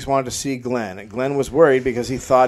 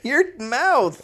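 stoned. Acted. Acting stoned Glenn.

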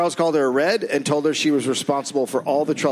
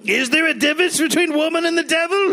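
mock governor; 3 dB louder than usual.

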